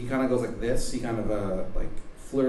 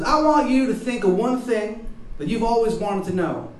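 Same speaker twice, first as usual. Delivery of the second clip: high, loud, bad Baltimore accent; shitty Baltimore accent; 10 semitones higher, 7 dB louder than usual. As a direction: introduce yourself in a hammed-up Baltimore accent. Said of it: "shitty Baltimore accent".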